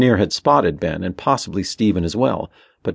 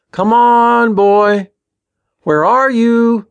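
loud, high, stretched-out call; calling a dog; 13 semitones higher, 8 dB louder, much slower than usual. A narrator's reading. Ben looking for Sparky, calling out loudly. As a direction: holler slowly for a missing dog. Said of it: "Ben called".

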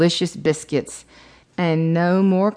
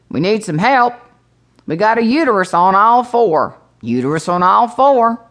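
mock public-address call, pitched up; store loudspeaker announcer; 4 semitones higher, 4 dB louder than usual. Store worker calls for help over the loudspeaker, mock announcement. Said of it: "hear the Walmart folks on the loudspeaker".

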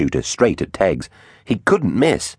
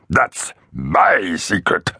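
very slow, rasped gloating; rasping raptor; much slower than usual. A narrator's reading. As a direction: rasp it out, savoring each word. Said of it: "rasped the Oviraptor".

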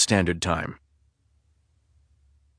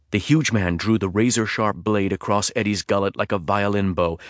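plain reading voice

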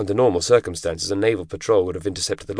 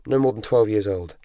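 ordinary delivery, nothing marked

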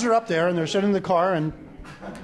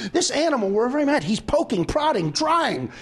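higher-pitched comic complaint, punching each verb; mock exasperation; 5 semitones higher than usual. Dog owner complains to another lady, mock exasperated. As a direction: build the list in acted exasperation, stretching the end.

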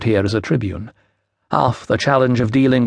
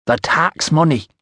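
put-on slow, higher Iceni-accented clarification; foreign Iceni accent; 4 semitones higher than usual. A narrator's reading. Woman clarifies for the startled official, Iceni accent. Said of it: "trouble following your accent".

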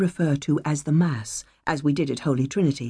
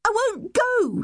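put-on much higher, slow stubborn ten-year-old girl; defiant child; slower than usual. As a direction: dig in like a child refusing to move. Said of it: "she said".

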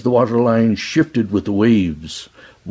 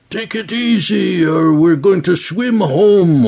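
high raised call over engine noise; shouting over machinery; 9 semitones higher than usual. A narrator's reading. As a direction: yell it over the roar of the boat. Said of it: "Ogal yelled over the roar of the engine".

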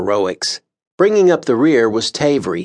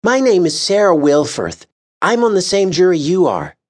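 high, friendly rising hello; young woman greeting warmly; kind greeting; 9 semitones higher than usual. A narrator's reading. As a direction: greet him with a kind smile. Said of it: "with a kindness in her eyes".

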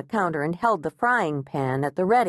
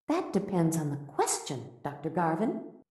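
much softer, dry comeback; quiet retort; 8 dB quieter than usual. A narrator's reading. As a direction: murmur the clever reply. Agnes retorts to therapist.

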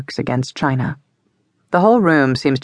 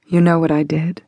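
ordinary delivery, nothing marked

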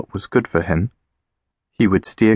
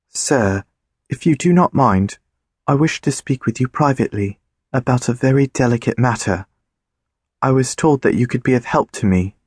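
higher, poised and proper lady; Davina voice; 5 semitones higher than usual. A narrator's reading. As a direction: speak like a composed, confident lady. Davina asks politely.

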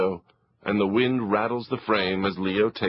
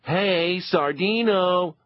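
teasing call; 8 semitones higher, slower than usual. Acted. Brother calling out.

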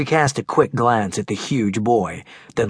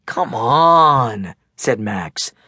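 put-on big teasing kid; 2 semitones higher, 4 dB louder, slower than usual. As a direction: tease your friend loudly, laughing it off. Max coaxing Megan.

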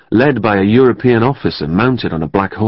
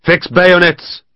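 command; 8 semitones higher, slower than usual. A narrator's reading. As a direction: snap out the order to the men. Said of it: "He growled an order".

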